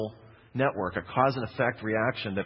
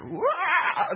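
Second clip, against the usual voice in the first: high, loud mock toddler wail; imitated child's wail; 4 dB louder than usual. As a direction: let out a kid's piercing cry. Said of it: "Just screamed".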